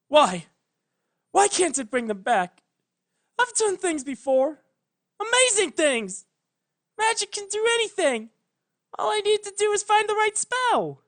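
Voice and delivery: high, loud, rising desperation; desperate pleading. A narrator's reading. Twilight insists to Luna that magic can bring them back, frantic high cry.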